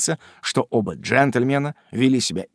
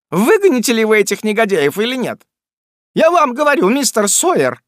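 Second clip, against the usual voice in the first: high, loud stamping demand; сердитое требование; 10 semitones higher, 6 dB louder than usual. Acted. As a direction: stamp your feet and insist. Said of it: "заключила миссис Раддль, топая обеими ногами". (хозяйка топает ногами и высоко, громко требует)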